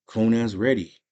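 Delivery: ordinary delivery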